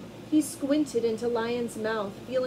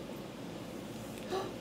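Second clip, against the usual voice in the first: sharp unvoiced gasp; breathy stagey gasp; 9 semitones higher, 11 dB quieter than usual. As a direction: gasp as if startled. Narrator reacts to trap.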